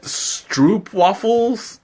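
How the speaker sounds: slowly sounding out a strange word, question rise. Real, not acted. Streamer reading an unfamiliar snack name from chat, slow and puzzled. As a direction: drag out each syllable as if reading it for the first time.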